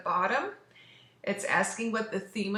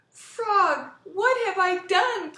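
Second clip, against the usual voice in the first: high, loud, panicked cry; frightened cry; 11 semitones higher, 9 dB louder, slower than usual. Acted. Toad fears he lost Frog, high loud cry. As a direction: cry out in dismay. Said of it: "cried Toad".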